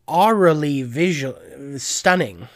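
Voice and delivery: ordinary talk, nothing marked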